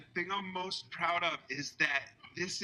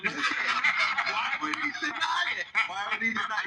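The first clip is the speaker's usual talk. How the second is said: mock outrage; 7 semitones higher, 5 dB louder than usual. Mock accusing Andy on talk show.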